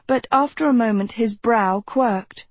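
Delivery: usual speaking voice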